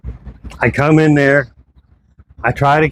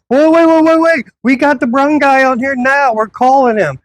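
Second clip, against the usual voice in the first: panicked officer imitation; 12 semitones higher than usual. The officer begging him not to leave, frantic and high. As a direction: mimic him begging him to stop.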